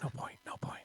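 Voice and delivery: hushed movie quote; whispered imitation. Imitates referee in film's fight.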